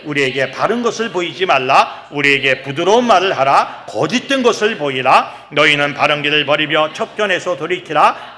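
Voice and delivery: measured chant-like reading aloud; scripture reading. Real, not acted. Preacher leading congregation through Isaiah, solemn reading aloud. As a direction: read the verse out, swelling on the last word.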